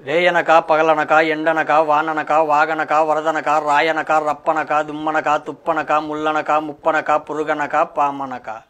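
NTR dialogue. Pretend. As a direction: rattle off each phrase in a declaiming beat. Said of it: "NTR dialogue ఇదో".

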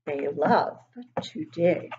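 ordinary delivery, nothing marked

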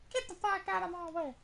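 very high cartoon squeak; high mock-cute cursing; squeaky voice. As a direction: squeak it like an angry chipmunk. Squeaky voice again.

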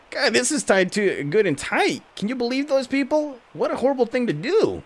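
high outraged townsperson; squeaky indignant bystander; character voice. Acted. Townsperson voiced, opening sack.

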